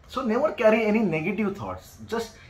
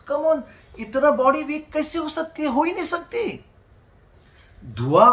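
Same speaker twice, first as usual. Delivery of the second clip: worked up, higher-pitched protest; getting emotional; 3 semitones higher, faster than usual. Real, not acted. Doctor protesting that the body cannot be so weak, emotional. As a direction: insist with real, rising emotion. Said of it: "Sorry, I am getting emotional".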